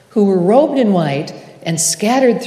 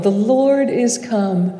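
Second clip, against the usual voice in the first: usual speaking voice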